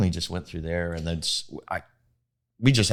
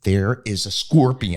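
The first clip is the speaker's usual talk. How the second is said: calm; 3 semitones higher, 6 dB louder, slower than usual. Retold or imitated. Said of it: "No, I didn't say it that calm".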